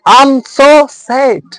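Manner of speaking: "sad" dropping in pitch at the end; slow, deliberate example with a fall on "sad"; falling intonation. Shown as a demonstration. Teacher giving class an example, falling intonation. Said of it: "examples of full intonation".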